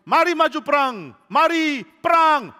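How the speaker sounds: high, loud, drawn-out battle call; rally the troops, stretching the last word; war leader's cry. Acted. Would-be leader urging troops to war, loud battle cry. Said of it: "pemimpin perang kayak gitu".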